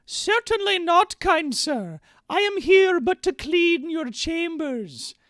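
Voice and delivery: very high, loud, slow falsetto maid; prim maid voice. Acted. Streamer reading the maid's lines, high falsetto. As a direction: squeak it out like a coy, flustered maid. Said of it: "I'm playing both the characters".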